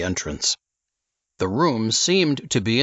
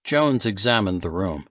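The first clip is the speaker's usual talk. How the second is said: ordinary delivery, nothing marked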